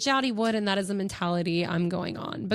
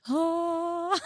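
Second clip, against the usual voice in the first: high, drawn-out smitten "oh"; swooning; 9 semitones higher than usual. Acted. Woman hears handsome Englishman start talking, swooning. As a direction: melt into a high, dreamy coo.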